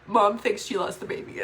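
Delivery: usual speaking voice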